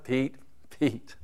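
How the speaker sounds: quiet, head-shaking call of a friend; soft weary sigh. Acted. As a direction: say it softly, like shaking your head at him. God sighing at Peter.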